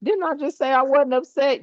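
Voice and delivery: baffled disbelief. Retold or imitated. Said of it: "You looking like".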